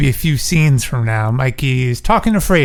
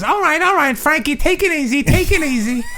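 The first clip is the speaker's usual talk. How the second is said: much higher put-on voice, laughing at the end; Fredo impression; 12 semitones higher than usual. Fredo calming Frankie down, high put-on voice. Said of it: "Fredo says".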